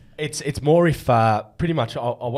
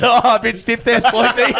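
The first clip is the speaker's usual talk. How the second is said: teasing jab; 11 semitones higher, 8 dB louder than usual. Retold or imitated. Tommy mocking teammate.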